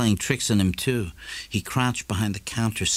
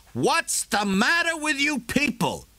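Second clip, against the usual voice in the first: much higher, slow exasperated shout. A narrator's reading. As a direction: yell through the door, fed up. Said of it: "shouting through the door".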